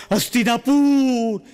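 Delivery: a patient yelping his address mid-injection; yelp of pain. Acted. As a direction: blurt the answer as the needle goes in. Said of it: "કેવી બૂમ પડી જાય".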